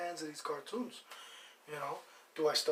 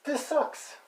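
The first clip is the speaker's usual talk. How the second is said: higher, louder mock complaint; imagined grocery shopper; 10 semitones higher, 5 dB louder than usual. Host imitating people in the grocery store, high-pitched complaint. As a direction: voice a griping shopper in a raised pitch. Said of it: "people in public in the grocery stores like".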